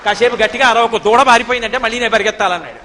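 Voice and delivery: loud mock-angry telling off; angry scolding. Krishna scolds the milkmaid to stop shouting, loud mock anger. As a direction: scold her loudly to hush. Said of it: "కోపంగా కనుబొమ్మలు ముడేసి అన్నాడు".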